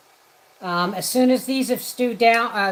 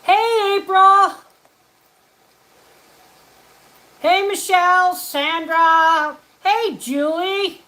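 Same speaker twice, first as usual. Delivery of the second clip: much higher and louder happy greetings; excited shouting; 7 semitones higher, 5 dB louder, slower than usual. Real, not acted. Livestream host greeting each viewer by name, excited shouting. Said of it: "I shout, I get excited".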